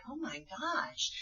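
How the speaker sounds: slow hushed "oh my gosh" with a rising end; softly dawning shock; stunned realization. Retold or imitated. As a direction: newcomer realizing she belongs here. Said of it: "And then they're like".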